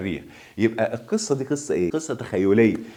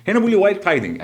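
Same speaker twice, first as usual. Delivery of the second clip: louder, higher restart; raised voice; 9 semitones higher, 5 dB louder, much faster than usual. Real, not acted. Teacher restarting the sentence over noisy birds, raised voice. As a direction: pitch it up to carry over noise.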